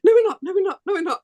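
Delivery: rising, protesting no we're not; indignant denial. Retold or imitated. Her head answering the claim that Australia and New Zealand are similar, indignant denial. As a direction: fire back the denial, pitched high. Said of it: "my head's going".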